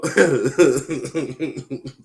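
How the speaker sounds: loud, high-pitched laugh; a loud run of giggling; hearty laughter. Real, not acted. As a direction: burst into laughter. Laughing at co-host's argument.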